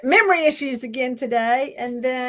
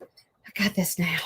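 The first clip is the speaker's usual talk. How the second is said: breathy whisper, slow, just for herself; 4 semitones lower, slower than usual. Real, not acted. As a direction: whisper it under your breath while concentrating. Muttering to herself while crafting.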